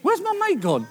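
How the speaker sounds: high, loud mock disbelief; stunned at the sudden change; baffled. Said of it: "you sort of stand there and you think".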